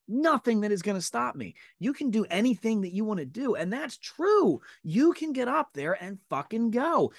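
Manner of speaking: rally the listener, voice rising to a peak; slowing down and going high on "And that's true"; fired-up pep talk. Real, not acted.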